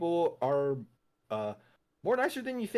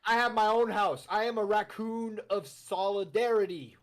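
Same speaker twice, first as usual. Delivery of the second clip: mock indignant; 6 semitones higher, 3 dB louder than usual. Raccoon character defends its honor.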